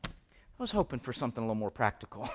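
mock disappointed aside. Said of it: "I know you're thinking".

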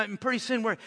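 ordinary talk, nothing marked

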